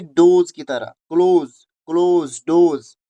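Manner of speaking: क्लोज said as kloze and rhymed with डोज, both punched loud; loud pronunciation demo. Shown as a demonstration. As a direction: hammer home क्लोज and डोज. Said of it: "कैसी आवाज उसकी निकलेगी?".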